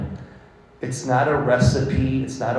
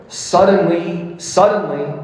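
dramatic reading; 7 semitones higher, 7 dB louder than usual. Acted.

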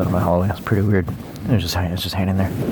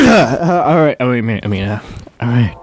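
loud, raised ASMR attempt; too loud; 4 semitones higher, 6 dB louder than usual. Real, not acted. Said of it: "No, that's too hard".

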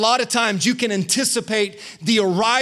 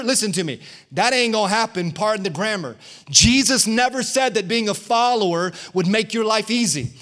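usual speaking voice

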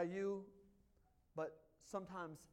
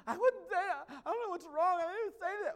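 higher, louder mock child protesting; mimicking a sulky kid; 10 semitones higher, 13 dB louder than usual. Dad mimicking his kid denying he said anything. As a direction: ham up the kid's defensive denial. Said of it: "you said".

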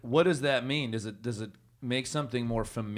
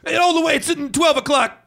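much higher, louder brash radio voice; Mad Dog impression; 7 dB louder than usual. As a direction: crank the pitch up and bark it out. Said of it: "do an impression".

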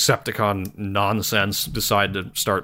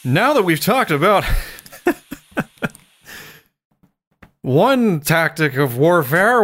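hammed-up host asking George a question; mock talk-show host; 6 semitones higher than usual. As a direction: play a big showy interviewer putting George on the spot.

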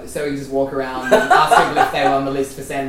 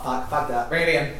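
ordinary talk, nothing marked

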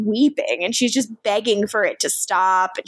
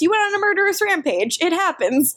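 Damon brushing it off, higher and fast; mocking Damon; 8 semitones higher, faster than usual. Damon shrugging off Rose's killing spree, mock higher and fast. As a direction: toss off the rampage like it's nothing. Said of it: "and he says".

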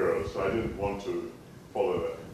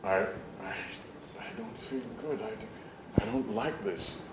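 despairing inner voice; 10 dB quieter than usual. Retold or imitated. Boy alone under a tree, quietly miserable. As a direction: quietly admit you feel awful.

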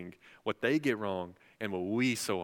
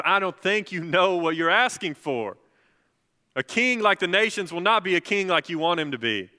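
preached warning; 8 semitones higher, 11 dB louder than usual. Acted. Samuel's warning, preached loud.

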